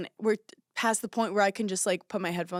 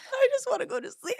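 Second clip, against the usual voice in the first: whiny. Retold or imitated. Whiny inner voice on plane.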